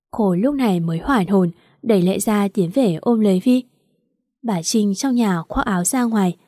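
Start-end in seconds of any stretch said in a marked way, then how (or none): none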